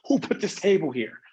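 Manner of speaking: annoyed high yelp at the table; mock irritated outburst. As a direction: snap at the table after stubbing a toe. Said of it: "And I was just like".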